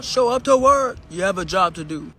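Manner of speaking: mocking the secretary. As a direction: throw the boss's order back at him. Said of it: "Terry Duffy talking about".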